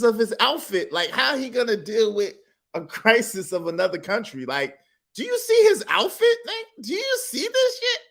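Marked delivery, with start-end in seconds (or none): 5.2-8.0 much higher, squeaky disbelief; can't believe what he's seeing; incredulous. Real, not acted. Reactor mocks the outfit in high incredulous squeak.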